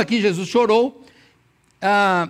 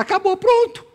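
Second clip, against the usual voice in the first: much higher imagined complaint; mock exasperation; 13 semitones higher, 4 dB louder than usual. Preacher voices Maria giving up on Jesus, exasperated. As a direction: throw up a high-pitched, acted giving-up. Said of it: "Ele vem e chora, fala".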